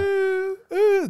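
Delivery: high mock crying wail, way up; hammed-up child sob; imitated child wail. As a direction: ape a kid wailing in his room. Imitating son crying in room.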